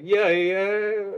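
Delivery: high screaming rock imitation; [name]. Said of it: "He's like".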